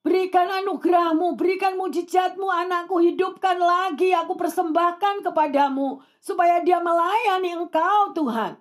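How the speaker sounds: high, urgent imploring of God; acted pleading prayer. Mother begs God to revive her child, acted pleading prayer. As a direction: imitate a mother begging in prayer.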